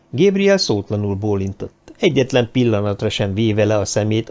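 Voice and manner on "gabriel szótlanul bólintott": usual speaking voice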